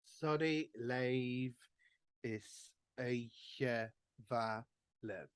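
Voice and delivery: "Eiche Va Lev" drawn out slowly, stress following the music's rhythm; rhythmic Gaelic line. Shown as a demonstration. Teacher modelling the chorus rhythm slowly for the class. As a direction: say "Va" long, as the tune would carry it. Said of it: "because of the stress of the rhythm of the music".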